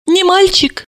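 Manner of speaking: high, hopeful asking; hopeful question. A narrator's reading. Mother asking about the unborn baby, hopefully. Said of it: "с надеждой спросила".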